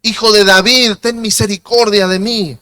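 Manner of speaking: loud, high cry for mercy; a blind beggar shouting over the crowd; shouting plea. Retold or imitated. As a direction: shout it out to Jesus. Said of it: "Y por eso gritó".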